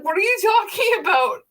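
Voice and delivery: high, baffled question; confused. Retold or imitated. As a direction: sound baffled and alarmed. Retelling her own confusion.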